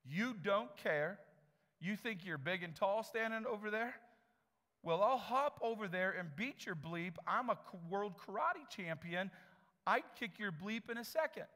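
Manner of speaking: hammed-up furious threat; angry customer. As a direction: bark threats across the counter like a tough guy. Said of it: "The guy says".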